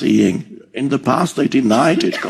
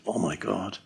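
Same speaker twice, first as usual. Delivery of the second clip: soft, low murmur of dread; worrying; 5 semitones lower, 12 dB quieter than usual. Acted. Drawn from thought to thought at night, hushed worry. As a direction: sink into a quiet, mock-worried mutter. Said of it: "one thought leads to another thought".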